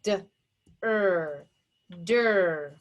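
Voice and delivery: "d", then "er", then blended "der"; segmented phonics demo of der; tapped out. Shown as a demonstration. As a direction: sound out D, ER separately, then join as DER. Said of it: "Tap it out".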